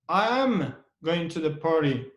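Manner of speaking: am held with extra force; stress on am. Shown as a demonstration. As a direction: lean on am, then fall away. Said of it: "we put a stress on the word".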